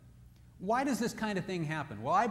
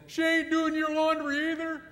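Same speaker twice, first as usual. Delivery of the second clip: much higher, louder mocking guys; the guys' mocking retort; 13 semitones higher, 6 dB louder than usual. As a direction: rib him like the guys would. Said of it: "they'll all say".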